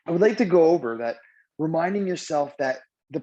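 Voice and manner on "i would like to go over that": normal talking voice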